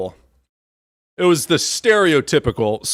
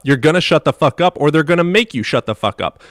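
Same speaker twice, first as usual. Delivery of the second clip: usual speaking voice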